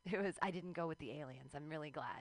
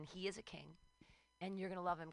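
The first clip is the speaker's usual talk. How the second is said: ordinary talk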